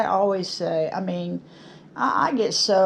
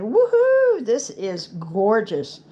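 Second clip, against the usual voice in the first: excited whoop; 2 semitones higher, slower than usual. Retold or imitated. Showing off her finished wood piece, excited whoop. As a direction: whoop it out with delight, then savour the words. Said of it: "I was like".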